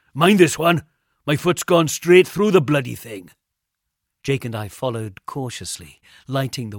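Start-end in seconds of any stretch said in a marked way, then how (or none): 0.1-3.3 loud, higher shout back to the others; alarmed warning. A narrator's reading. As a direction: call out a gruff warning. Warning after foot breaks step.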